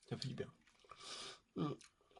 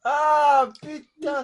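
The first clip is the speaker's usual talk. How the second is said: high, loud cry of disgust; disgusted yelp; 12 semitones higher, 12 dB louder, much slower than usual. Real, not acted. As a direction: shriek at the awful taste. Tasting a disgusting jelly bean.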